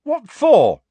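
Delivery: loud, high indignant demand; angry. A narrator's reading. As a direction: fire it back in anger. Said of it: "said Harry angrily".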